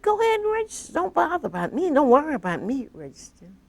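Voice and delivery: high, loud mock-casual dismissal to friends; breezy brush-off. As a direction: wave them off brightly, as if nothing is wrong.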